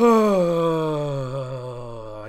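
loud, long, falling sigh; big dramatic sigh. Pretend. Starting a mock sponsor testimonial, long dramatic sigh. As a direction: heave a huge voiced sigh.

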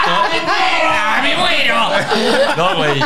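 laughing shout. Real, not acted. Friend cracking up at a joke, high laughing shout. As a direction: burst out howling with laughter.

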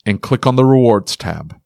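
upbeat sponsor read. Acted. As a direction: sell it like a podcast ad.

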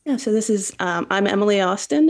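normal talking voice

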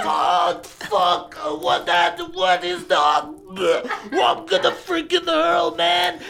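put-on high, strained gagging kid, words stretched out slow; a kid about to throw up, voice climbing high; disgusted kid. Kid gets shit in mouth.

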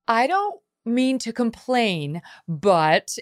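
put-on carefully polite little boy about to complain; polite kid. Mother imitating her son's new polite complaining, slow. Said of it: "Thatcher going".

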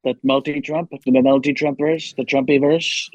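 lightly voiced boastful president impression; Donald Trump. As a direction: play a rambling, self-congratulating president. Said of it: "back to Trump".

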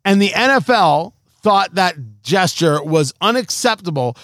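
ordinary talk, nothing marked

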